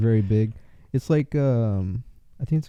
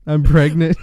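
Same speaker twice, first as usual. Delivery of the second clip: laughing imitation; 4 semitones higher, 7 dB louder than usual. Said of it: "Is that what you said?".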